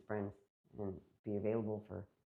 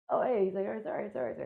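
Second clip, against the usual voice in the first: much higher mock apology, starting loud; imitated hasty apology; 10 semitones higher, 8 dB louder than usual. Woman mimics officer backing off after her challenge, mock apology. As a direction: backpedal quickly in a put-on higher voice.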